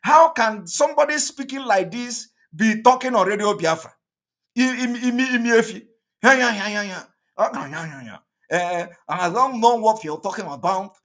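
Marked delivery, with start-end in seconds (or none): 4.6-10.9 imitating stammering voice. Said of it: "this kind of people speaking like this".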